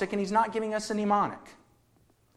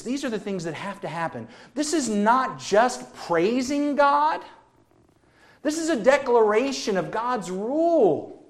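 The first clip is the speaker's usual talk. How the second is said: usual speaking voice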